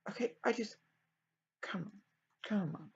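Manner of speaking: hushed, half-whispered murmur; quiet. Real, not acted. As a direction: say it under your breath, absorbed in a task. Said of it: "I'm so sorry, I'm so quiet".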